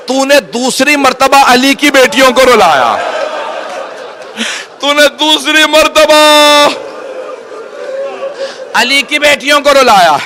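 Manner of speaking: slow, rising, crying lament; anguished self-reproach. Acted. Hurr blaming himself for their tears, slow anguished lament. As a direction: drag the words out in grief.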